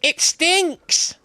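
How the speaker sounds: very high mock woman's cry. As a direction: imitate a woman wailing from a toilet cubicle. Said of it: "hear this woman's voice just go".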